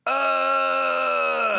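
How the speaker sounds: high, loud pinched scream; wrong strained scream. Shown as a demonstration. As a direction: force it from the throat. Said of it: "you don't wanna go".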